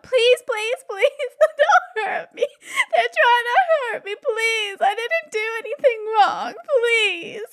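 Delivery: put-on high, loud wailing plea of a terrified child; hammed-up high child bawling; sobbing child. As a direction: beg through sobs like a small boy. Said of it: "starts bawling, just crying his eyes out".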